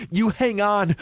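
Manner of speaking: high, insistent order; demanding. Acted. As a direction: order him not to give up. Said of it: "I demanded".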